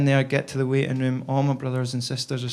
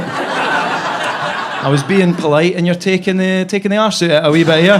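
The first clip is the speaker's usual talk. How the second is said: laughing, louder complaint, speeding up at the end; laughing as the dad's complaint rushes out; laughing through it; 4 semitones higher, 8 dB louder than usual. Retold or imitated.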